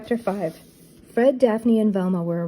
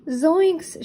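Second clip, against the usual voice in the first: high, loud frightened yelp; startled exclaim; 10 semitones higher, 3 dB louder than usual. A narrator's reading. Shaggy hearing the ghost pirate on the radio, startled. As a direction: cry out in alarm. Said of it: "Shaggy exclaimed".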